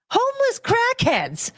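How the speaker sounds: very high, slow, drawn-out jeer; high mocking squeal. Real, not acted. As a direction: squeal it out like a punchline. Mocking snail eaters.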